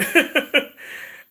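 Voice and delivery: high squealing laugh; real laugh, high and loud; high burst of laughter. Real, not acted. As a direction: let out a loud high cackle. Laughs at mom's saying.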